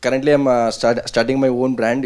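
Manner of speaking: normal talking voice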